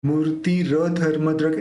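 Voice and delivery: मूर्ति र धर्मद्रक में र साफ़ और पूरा बोलें; र को अलग, पूरा उच्चारित करें. Shown as a demonstration. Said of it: "ऐसे र पूरा निकालना है". (र पूरा निकालते हुए)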